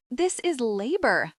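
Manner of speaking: high, slow, exasperated outburst; shouting. Acted. Teacher exasperated with a meddling mum, outburst. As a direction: snap it out, losing patience. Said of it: "Nora shouts".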